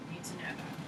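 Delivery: breathy low-voiced aside to the class; whispered aside. Real, not acted. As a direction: drop to a secret whisper. Teacher aside, sudden whisper.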